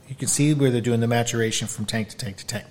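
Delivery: ordinary talk, nothing marked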